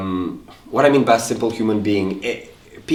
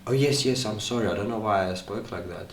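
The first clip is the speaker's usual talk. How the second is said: soft, deflated acted apology; subdued apology; 10 dB quieter than usual. Father apologising to son after outburst, softly contrite. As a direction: back down quietly, sounding sorry.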